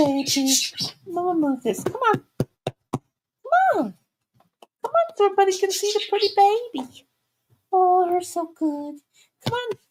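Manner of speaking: high cooing call to the puppy; coaxing the dog. Real, not acted. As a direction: coax her up in a high sweet voice. Coaxing the dogs.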